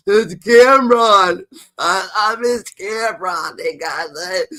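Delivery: high, slow, drawn-out mock whimper; mock sobbing whine. Mocking Jim Jones pining for Cameron, high whimpering. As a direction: sob it like a sulking kid.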